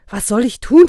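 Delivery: loud, high-pitched helpless question; exasperated. A narrator's reading. Husband at a loss answering his wife, exasperated. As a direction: throw the question back in exasperation.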